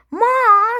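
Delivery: very high, very loud scream; Henry screaming for mom about Peter. A narrator's reading. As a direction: shriek for mom. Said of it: "screamed Henry".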